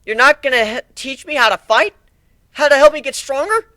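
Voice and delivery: high, loud plea; pleading young colt. Acted. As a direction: beg to be taught. Whiplash pleading.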